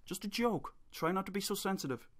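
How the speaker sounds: high, sing-song mock of the trolls; sarcastic high mimicry of the excuse. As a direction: parrot their excuse back at them, soft and high.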